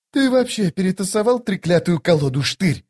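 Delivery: a hardened woman demanding answers at the card table; gruff woman soldier. A narrator's reading. Woman at cards questions Штырь. (ворчливый голос женщины-солдата за картами)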